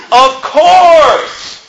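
high, loud, matter-of-fact; as if it's too obvious to ask; mock obvious answer. Youth group answering the speaker, loud and certain. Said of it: "every single kid in the youth group said".

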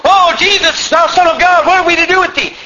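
high-pitched mock outburst; hammed-up possessed man's wail; crying out. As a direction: cry out as if out of control. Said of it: "jumps up and cries out".

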